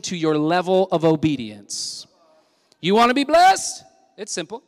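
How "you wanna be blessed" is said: high, loud challenge; preaching call. Acted. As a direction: throw the question out to the church.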